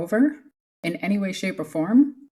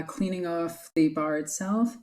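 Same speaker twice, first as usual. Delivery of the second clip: ordinary talk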